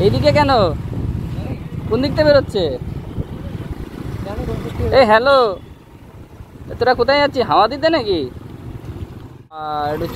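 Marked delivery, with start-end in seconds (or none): 0.0-8.2 calling out. Real, not acted. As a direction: shout over the traffic. Calling to other riders on road.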